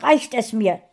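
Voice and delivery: laut und hoch ausgerufen; wütend. A narrator's reading. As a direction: die Geduld verlieren. Frauchen platzt der Kragen. (laut und wütend ausrufen)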